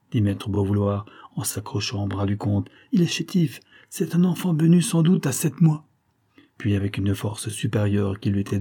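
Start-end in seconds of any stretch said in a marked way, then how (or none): 2.9-5.9 high-pitched, anxious explanation; urgent pleading. A narrator's reading. Bonesetter pleads for the frail baby with count, high urgent voice.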